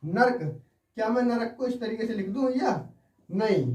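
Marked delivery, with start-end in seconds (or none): none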